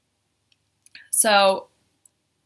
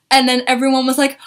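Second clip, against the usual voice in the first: ordinary talk, nothing marked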